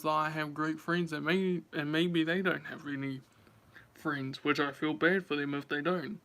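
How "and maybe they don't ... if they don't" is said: usual speaking voice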